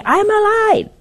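high, loud mock yell; insistent cry. Man tells wife who cannot hear him, insistent high cry. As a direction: cry it out high, desperate to be heard.

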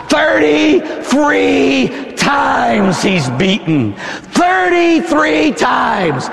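high, shouted, stretched-out pulpit cry; full-force preaching. Real, not acted. Preacher crying out the thirty-three lashes, full-force shouted preaching. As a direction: hammer out the count at full stretch.

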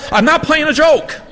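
put-on high excited New England accent shout. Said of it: "He has this wonderful New England accent".

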